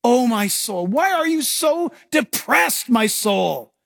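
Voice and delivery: anguished plea. Acted. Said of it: "as he's crying in his distress".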